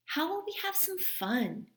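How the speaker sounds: high, whiny grumble; grumbling kid. A narrator's reading. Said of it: "Henry grumbled".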